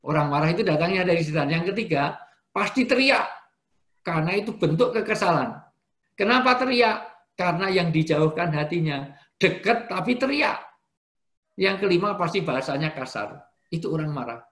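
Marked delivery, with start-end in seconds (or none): 2.5-3.3 shouting. Shown as a demonstration. Preacher acts angry shouting. 9.4-10.6 yelling though close; mock shout. Shown as a demonstration. Preacher mimics shouting.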